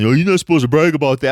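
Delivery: mock judging voice. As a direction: parody the people tut-tutting him. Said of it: "I can hear people right now judging".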